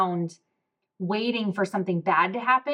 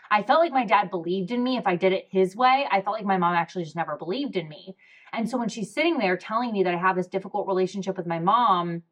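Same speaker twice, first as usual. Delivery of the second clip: normal talking voice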